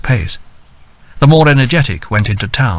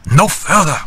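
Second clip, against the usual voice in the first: low and sharp; 6 semitones higher than usual. A narrator's reading. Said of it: "low and sharp".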